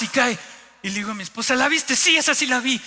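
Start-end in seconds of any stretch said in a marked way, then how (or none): 1.6-2.8 high, loud eager back-and-forth; excited exchange. Retold or imitated. Husband and wife after the second whale, excited. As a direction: retell it with raised excitement.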